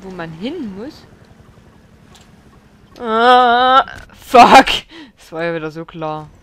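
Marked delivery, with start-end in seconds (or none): 3.0-4.7 loud angry outburst; frustrated curse. Real, not acted. Gamer caught again, loud angry cursing. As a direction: spit out the curse in real frustration.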